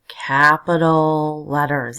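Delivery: CAPITAL LETTERS drawn out slowly and loud; emphatic reminder. Shown as a demonstration. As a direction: stretch "capital letters" loudly, as a rule to remember. Teacher stressing a rule.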